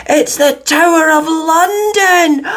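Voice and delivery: high, slow, loud cry of wonder; excited chorus. A narrator's reading. Children at the Tower, an excited cry. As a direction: gasp it out in delight at the sight. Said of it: "chorused Jonathan and Judy excitedly".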